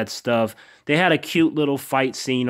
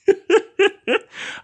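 high, sudden burst of real laughter. Real, not acted. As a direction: break out laughing at the line. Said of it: "I started laughing".

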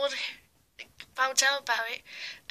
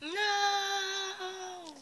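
defeated cry; 7 semitones higher, 5 dB quieter than usual. Acted. Villain defeated.

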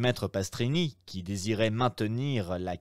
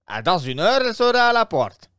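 higher, louder obsequious innkeeper voice; innkeeper, fussy; 10 semitones higher, 9 dB louder, faster than usual. Acted. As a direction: play the eager Roman host, pitched up. (aubergiste empressé, voix plus aiguë et plus forte)

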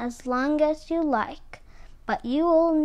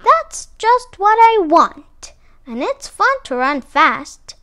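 delighted shouting; 6 semitones higher, 10 dB louder than usual. A narrator's reading. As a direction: exult loudly, stretching the first words. Armadillo goes on shouting, delighted.

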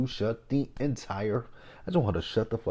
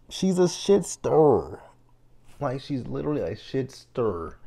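exasperated emphasis; 6 semitones higher, 4 dB louder than usual. Real, not acted. Complaining about Keely.

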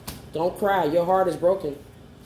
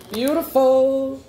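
higher and louder, very slow and drawn out; delighted; 6 semitones higher, 4 dB louder, much slower than usual. Real, not acted. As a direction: savour the word with delight. Admires the sun card again.

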